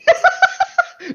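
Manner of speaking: a big high burst of laughter; shrieking laugh at the joke; loud laugh. Real, not acted. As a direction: crack up out loud.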